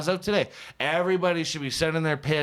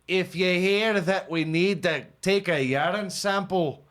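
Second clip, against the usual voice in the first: mock Scottish accent; 4 semitones higher than usual. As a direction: put on a hammed-up Scottish accent reading a notice. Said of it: "That accent".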